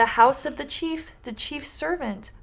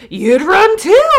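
1 talking cloth; 9 semitones higher, 11 dB louder, slower than usual. Acted. Cloth bundle voiced in story.

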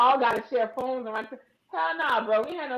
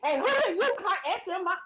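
high sputtering outrage; mock angry caller; 9 semitones higher than usual. Imitating the caller's high sputtering outrage. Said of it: "Be like".

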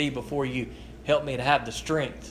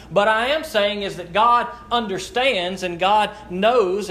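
normal talking voice

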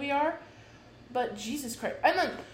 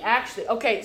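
ordinary talk

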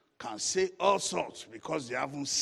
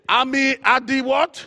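very loud, high preacher's question; shouted prompt; 10 semitones higher, 17 dB louder than usual. Real, not acted. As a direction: belt it out to make the congregation answer. Preacher prompts congregation for the answer.

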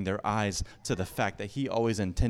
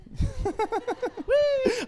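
helpless high giggle; high-pitched laugh. Real, not acted. Pastor laughing at the minion joke, high giggling laugh. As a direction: burst out in a squealing laugh.

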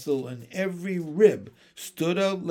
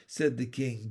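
usual speaking voice

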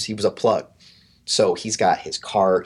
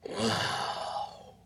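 long, breathy exhaled wonder; awed whisper; 3 semitones higher, 12 dB quieter than usual. Retold or imitated. Friend on the back of the camel, awed whisper. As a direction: breathe it out, softly amazed.

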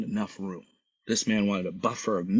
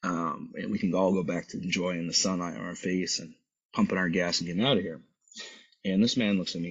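ordinary talk, nothing marked

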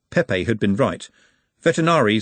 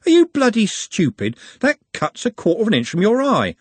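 higher, louder exasperated snap at a friend; exasperated outburst; 10 semitones higher, 3 dB louder than usual. Acted. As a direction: finally lose patience and let it out loud. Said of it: "Trev cracked".

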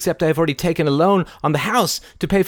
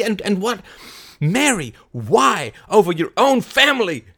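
louder, higher furious scolding; angry outburst; 6 semitones higher, 4 dB louder than usual. Acted. Larry angrily berates Kay over the money. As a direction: berate her hotly.

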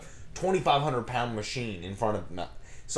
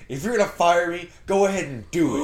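loud, higher-pitched indignant coworker; retold angry outburst; 7 semitones higher, 6 dB louder than usual. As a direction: act out a coworker daring the boss.

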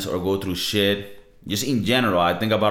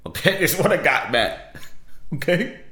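laughing it off; 7 semitones higher than usual. Real, not acted. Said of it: "laughs defending his accent".

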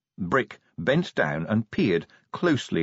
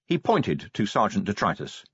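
ordinary delivery

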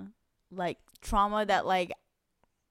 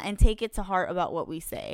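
usual speaking voice

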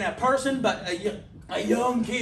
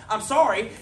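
normal talking voice

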